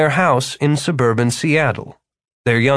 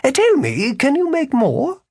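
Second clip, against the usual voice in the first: high, theatrical wizard; a pompous con-man wizard; 13 semitones higher than usual. Acted. Philip the wizard speaking.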